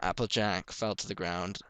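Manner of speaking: ordinary delivery, nothing marked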